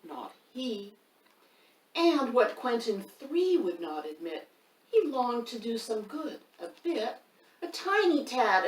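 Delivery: lively picture-book reading; reading to children. A narrator's reading. Reader performs the rhyming book for children. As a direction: read it to the kids with bounce.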